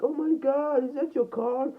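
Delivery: very high-pitched mock amazement; imitated shock. Girl seeing his Lamborghini, imitated high-pitched shock. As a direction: squeal it like an astonished girl. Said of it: "She was so shocked".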